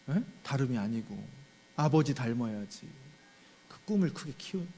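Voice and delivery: ordinary delivery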